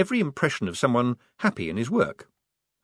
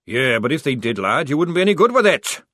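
gruff sergeant; 4 semitones higher, 7 dB louder than usual. Acted. Sergeant retorts.

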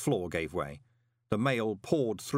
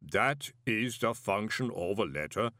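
calm golem. A narrator's reading. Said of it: "said Pump calmly".

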